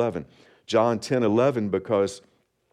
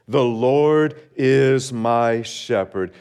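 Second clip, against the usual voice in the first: slow, louder call for all to say together; slow unison recitation; 4 dB louder, much slower than usual. Shown as a demonstration. Pastor leading the congregation in reciting the verse, slow and loud. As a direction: lead the room through it word by word. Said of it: "Would you say that with me?".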